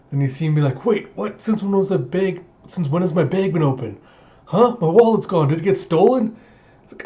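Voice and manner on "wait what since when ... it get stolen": high-pitched, alarmed questions; panicked discovery of a missing belonging, acted; mock panic. Player finds his bag open and wallet gone, mock panic.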